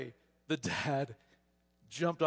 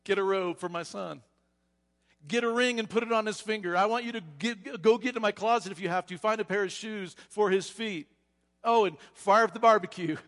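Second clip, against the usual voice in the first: loud, raised commands to the house; yelling orders; 6 semitones higher, 8 dB louder than usual. Acted. Father yelling orders to the household, loud and eager. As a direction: call out eagerly to the servants. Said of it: "he yells back toward the household".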